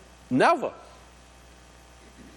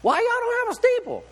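much higher, put-on voice of a puzzled stranger; visiting man's question. A passing man asks the preacher about the steeple, high put-on voice. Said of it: "a man comes along and says".

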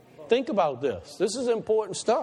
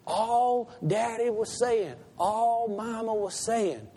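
tender parent; 3 semitones higher than usual. Acted. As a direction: comfort the hurt child gently. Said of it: "then you're saying".

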